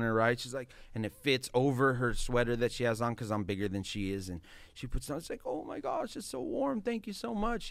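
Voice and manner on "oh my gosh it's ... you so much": very high imitation of a thankful woman; grateful woman. Woman thanking him for the warm jacket, high and grateful. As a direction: squeal the thanks in a put-on high voice.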